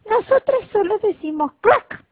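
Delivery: voz de rana muy aguda y lenta; regáñalo como ranita altanera; ranita burlona. Acted. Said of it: "respondió la ranita". (la ranita responde al cachorrito, voz aguda y burlona)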